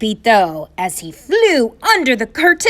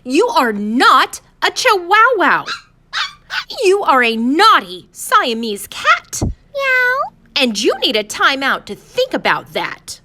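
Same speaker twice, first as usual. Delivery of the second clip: high, loud, punched-out telling-off; scolding mama; 9 semitones higher, 4 dB louder than usual. A narrator's reading. Mama sends her kitten to a timeout, scolding. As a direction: lay down the law, stressing each point. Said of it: "she scolded".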